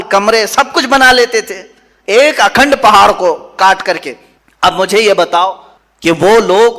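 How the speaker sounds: fiery pulpit preaching. Acted. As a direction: drive the sermon home with force. Impassioned sermon on Thamud.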